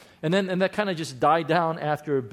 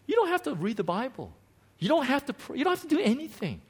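higher and faster with each line; rising preaching; 9 semitones higher, faster than usual. Acted. Pastor telling the congregation they owe God nothing, building higher. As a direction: build the list up to a peak.

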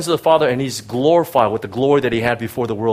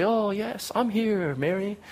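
softer, higher gentle welcome; a softer voice for the Jesus you'd expect; imagined tender Jesus; 8 semitones higher, 3 dB quieter than usual. Retold or imitated. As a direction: invite her in kindly. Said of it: "You think that Jesus would say".

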